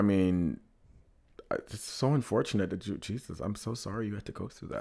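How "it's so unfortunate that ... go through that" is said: soft, sincere sympathy; compassionate. Real, not acted. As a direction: say it gently, consoling. Sympathizing with bad dating experience.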